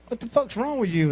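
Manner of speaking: retold exasperated outburst; exasperated question. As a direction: ask it high and incredulous. Acted question to the whimpering partner.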